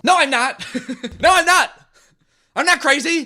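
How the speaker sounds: high, loud comic protest; mock outraged denial. Bully denies hearing things, high mock-defensive yelp. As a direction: blurt it in a squeaky overblown protest.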